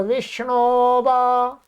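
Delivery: विष्णोवा slow, long ो running into वा; silent avagraha. Shown as a demonstration. Teacher restating the joined word, silent avagraha. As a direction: recite विष्णोवा as one drawn-out word. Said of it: "इसका कोई उच्चारण नहीं है".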